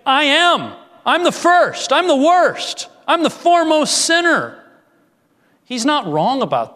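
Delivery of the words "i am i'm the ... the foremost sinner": high, loud, emphatic confession; Paul's loud confession. Retold or imitated. Preacher voicing Paul's bold confession, loud and high. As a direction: proclaim it high and loud as Paul. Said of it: "He's saying".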